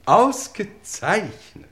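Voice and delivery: laut, langsam, dann lachend; genieße die gute Nachricht; erleichtertes Ausgezeichnet. Acted. (der Lord jubelt laut und langsam vor dem Inspektor)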